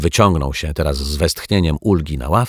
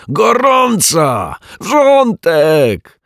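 drawn-out high yells, much louder; scalded howl; 12 semitones higher, 6 dB louder, much slower than usual. Acted. The burned lodger howling about the hot water. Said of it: "rozległ się jego wrzask".

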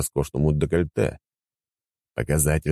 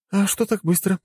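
lightly raised woman's voice; female character voice. Acted. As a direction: Anya speaking, higher. Anya voiced, female role. (женский голос)

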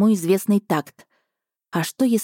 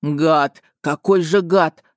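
angry shout; 2 semitones lower, much slower than usual. Acted. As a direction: spit it out furiously. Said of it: "выкрикнул он".

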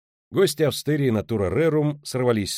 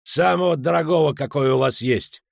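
higher, loud swaggering; boastful order; 4 semitones higher, 5 dB louder than usual. Acted. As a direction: show off to the room.

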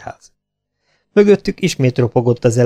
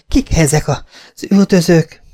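higher-pitched, panting question; out of breath; 6 semitones higher than usual. A narrator's reading. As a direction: gasp it out after running from pursuers. Gasping question after running. (lihegve, magasabb hangon feltett kérdés)

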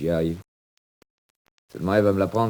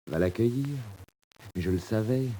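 ordinary talk

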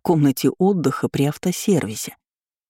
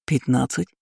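unsure; 3 dB quieter than usual. A narrator's reading. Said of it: "Уже не так уверенно произнёс".

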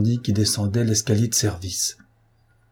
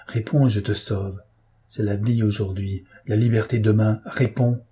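plain reading voice

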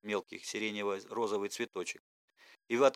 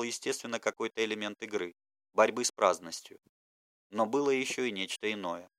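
ordinary delivery

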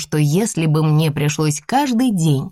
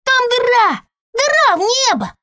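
put-on high thrilled little kid; excited toddler. A narrator's reading. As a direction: gasp out a discovery. Toddler delighted. (восторженный малыш)

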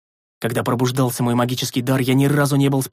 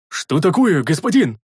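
higher, louder worried call; alarmed call; 4 semitones higher, 4 dB louder than usual. A narrator's reading. The big man calls to his master in alarm. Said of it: "встревоженно крикнул здоровяк".

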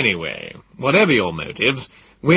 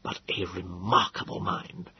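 soft, slow murmur; whispered; 8 dB quieter, slower than usual. A narrator's reading. Wallace confides quietly about the professor. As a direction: keep it hushed and confidential. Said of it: "he whispered".

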